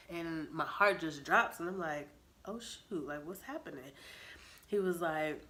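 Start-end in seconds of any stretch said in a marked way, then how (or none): 2.4-3.9 much softer, hushed shock; stunned dismay. Retold or imitated. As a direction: let it slip out under your breath. Heart drops reading breakup.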